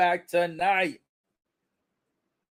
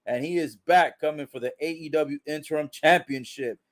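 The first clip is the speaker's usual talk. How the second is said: usual speaking voice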